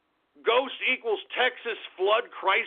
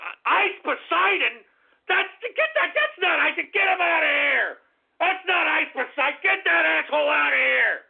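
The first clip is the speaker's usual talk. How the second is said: outraged shout; 6 semitones higher than usual. Real, not acted. Streamer spotting an impostor in chat, outraged shout. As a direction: kick him out, furious.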